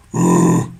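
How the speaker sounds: low, loud startled grunt. Real, not acted. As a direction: grunt as if hit. Startled reaction in horror game.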